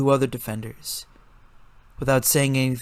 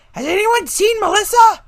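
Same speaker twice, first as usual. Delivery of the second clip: urgent shout; 9 dB louder than usual. A narrator's reading. Kevin calling urgently.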